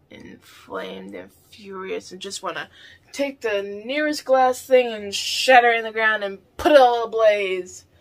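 ordinary talk, nothing marked

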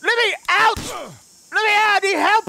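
trapped and calling for help; yelling for help. Acted. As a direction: shout to be let out. Trapped in crashed car, yelling.

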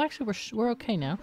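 ordinary talk

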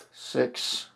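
ordinary delivery

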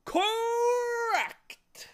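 very high, loud announcement; triumphant shout. A narrator's reading. Letterman ruling the bee's spelling right, loud high shout. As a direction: bellow it, stretching the word. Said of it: "shouted the letterman".

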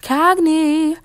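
high, loud, stretched-out yell; insistent drawn-out summons; calling out. A narrator's reading. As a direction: holler for someone out of sight. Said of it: "she heard again closer".

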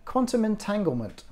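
high, eager daughter chiming in; pitched well up, keen to answer; piping up. A narrator's reading. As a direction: pipe up in a high child's voice. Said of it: "Rosalie piped up".

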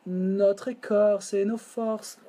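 slow, each syllable placed on the beat; slow counted line. Shown as a demonstration. Teacher fitting the lyric to the beat, slow and measured. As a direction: lay the words on the count, slowly. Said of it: "Un, deux, trois".

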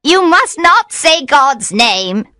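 high, slow, emphatic witch voice; drawn-out, high-pitched warning; protesting witch. A narrator's reading. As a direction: scold him in a high voice. Caught witch scolds her captor.

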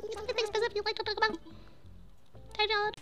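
high, hushed, rapid little voice; game character impression. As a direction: chatter softly up high like a tiny game character. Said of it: "That's how they sound".

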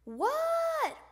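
high, loud, drawn-out call back; hollered reply. A narrator's reading. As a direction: yell back across the house. Froggy yells back to Dad.

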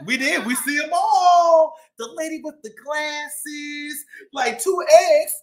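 lively shopkeeper cat telling his story; boastful bodega-boss cat, upbeat; cat narrator Chip. Acted.

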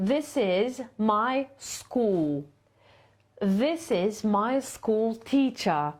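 slow, falling tune at the end of each sentence; intonation model. Shown as a demonstration. Teacher modelling English falling intonation, slow. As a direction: let the pitch drop on the last word. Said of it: "usłyszały tutaj intonację".

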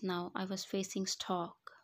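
ordinary delivery

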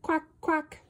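a light little quack; duck quack. Acted. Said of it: "Ducks say quack".

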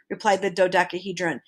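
usual speaking voice

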